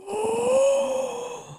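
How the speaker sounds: sharp high gasp of delight. Real, not acted. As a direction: catch your breath, thrilled. Delighted at the lights.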